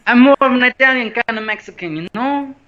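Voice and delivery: high, loud hammed-up fake Mexican accent. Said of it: "the fake one that no one actually speaks".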